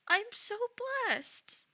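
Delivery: high and loud, bursting with joy; so happy it squeaks; excited gush. Real, not acted. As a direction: gush it out, overwhelmed. Thanked after a raid.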